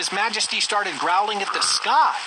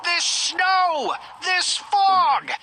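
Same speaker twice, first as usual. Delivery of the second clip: high, loud royal shout; angry shouting; 6 semitones higher, 3 dB louder, much slower than usual. Acted. King rages at the weather, shouting high and loud. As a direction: yell at the sky as a furious king. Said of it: "he started shouting".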